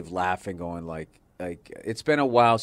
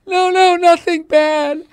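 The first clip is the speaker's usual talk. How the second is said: high, loud, drawn-out mock wail; hysterical crying; 12 dB louder, slower than usual. Wife reassures husband after watching a film, hysterical crying. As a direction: blubber slowly like an overcome wife. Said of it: "she was crying hysterically".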